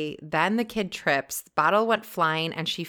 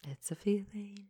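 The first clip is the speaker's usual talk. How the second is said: soft breath-only whisper; hushed agreement with her cohost; 3 semitones higher, 10 dB quieter than usual. Real, not acted. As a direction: whisper it knowingly. Whispered knowing reply.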